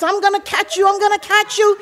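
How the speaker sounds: high mock-threatening call; chasing mom. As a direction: imitate mom chasing with a belt. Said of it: "my mother comes running behind us".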